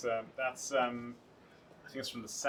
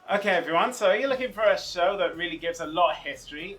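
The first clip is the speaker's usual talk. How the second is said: big, loud, high sales pitch to camera; booming upbeat promo read; 7 semitones higher, 14 dB louder than usual. Acted. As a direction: sell the show like an advert. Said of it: "pitching the show to viewers".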